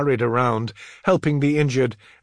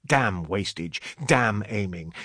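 ordinary delivery

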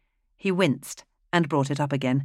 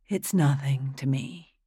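whisper. Acted. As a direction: breathe it, indifferent. Dark girl answers quietly.

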